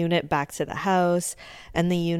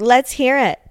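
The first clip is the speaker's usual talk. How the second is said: higher, louder taunting dare; mock defiant shout; 4 semitones higher, 7 dB louder than usual. Snooki daring the Unit, loud mock defiance. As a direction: dare him loudly to say it. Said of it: "She's like".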